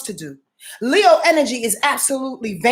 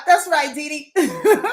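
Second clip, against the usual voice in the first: laughing; 6 semitones higher, slower than usual. Real, not acted. Laughing aside.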